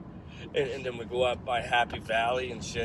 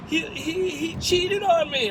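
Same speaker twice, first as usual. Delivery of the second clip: high, loud imitation of a crying woman; hammed-up tearful whine; mock crying; 5 dB louder, slower than usual. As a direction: stammer it out in a mock wail. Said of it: "crying her eyes out".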